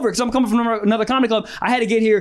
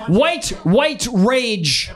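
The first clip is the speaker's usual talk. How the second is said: slow repeat; much slower than usual. Shown as a demonstration. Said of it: "repeating suggestion slowly".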